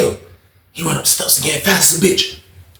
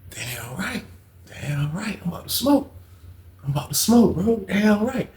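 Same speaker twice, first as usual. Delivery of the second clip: hushed; 4 semitones higher, 10 dB quieter than usual. Retold or imitated. High cellmate confessing his plan, hushed murmur. Friend quietly admits he'll smoke.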